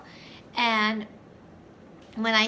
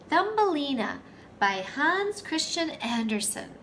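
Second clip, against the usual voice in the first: ordinary delivery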